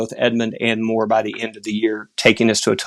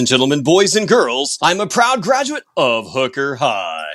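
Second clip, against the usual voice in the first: arena announcer voice; 3 semitones higher than usual. Pretend. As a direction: introduce yourself like a stadium announcer. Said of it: "if you'll allow the arena announcer voice".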